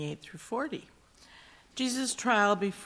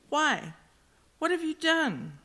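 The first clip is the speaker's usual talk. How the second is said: incredulous question; 9 semitones higher, 3 dB louder than usual. A narrator's reading. Pilate presses the prisoner.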